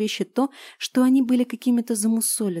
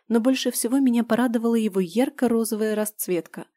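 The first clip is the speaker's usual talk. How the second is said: usual speaking voice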